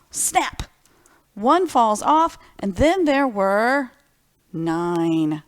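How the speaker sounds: put-on spring the surprise on the listening kids; dramatic reveal. Dramatic twig snap in story.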